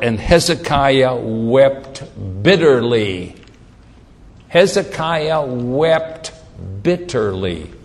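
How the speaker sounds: hushed, drawn-out ending; solemn scripture reading. Acted. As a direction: let each word of the verse sink in. Reads the verse slowly.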